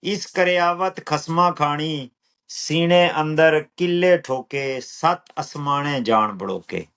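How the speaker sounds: slow, measured verse with drawn-out words; poetry recital. Acted. Poet reciting own lines to an appreciative listener, measured recital.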